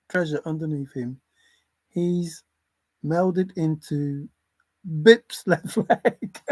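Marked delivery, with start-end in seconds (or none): 5.0-6.5 slow words broken by a laugh. Real, not acted. Describing a funny picture to viewers, laughing. Amused by leprechaun image.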